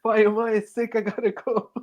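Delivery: a hammed-up anxious Tony; mock worried Tony. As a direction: rush off in a fret.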